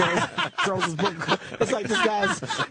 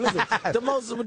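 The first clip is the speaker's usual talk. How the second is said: usual speaking voice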